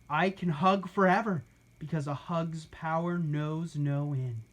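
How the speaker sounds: ordinary delivery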